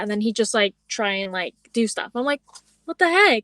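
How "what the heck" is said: higher, louder "what the heck"; a retold exasperated exclamation; indignant outburst. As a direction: snap it out in disbelief. Reacting to his unwanted advances.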